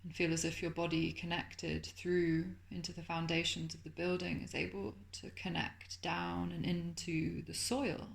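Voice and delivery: soft, calm meditation voice with lingering pauses; guided meditation. Acted. Teacher settling listeners into Yoga Nidra, soft guided meditation.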